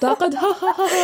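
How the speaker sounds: high, cackling mock laugh at the dialect; imitating relatives laughing at her Somali; mocking laughter. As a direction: laugh down at the word like a teasing relative. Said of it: "They're gonna be like".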